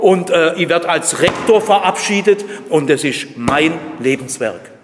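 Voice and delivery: loud, high-pitched boasting as the proud ego; a smug self-made man shouting his life's work; self-righteous boast. Acted. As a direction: crow about your own achievements, full volume. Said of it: "das selbstherrlich ist und das sagt".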